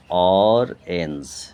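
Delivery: অরেঞ্জ very slow, long first beat; split in two syllables. Shown as a demonstration. Teacher repeating the breakup, অরেঞ্জ as slow, loud অর-এঞ্জ. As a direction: hold অর, then finish অরেঞ্জ. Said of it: "দুটো ভাগ".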